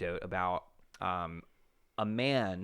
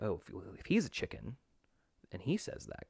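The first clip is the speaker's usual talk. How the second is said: normal talking voice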